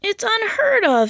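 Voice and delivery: scandalised gasp of a line; Ma aghast at her son; shocked mother. Acted. Ma exclaims in shock.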